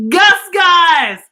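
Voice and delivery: high, loud delighted name; excited reveal. Acted. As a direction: cheer the mouse's name. Excited greeting of the mouse.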